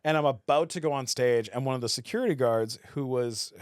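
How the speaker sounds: ordinary delivery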